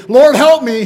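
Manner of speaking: loud pleading cry for help; desperate cry. Retold or imitated. Preacher voicing a frightened plea, loud pleading cry. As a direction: beg the Lord loudly, panicked. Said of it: "his is not a".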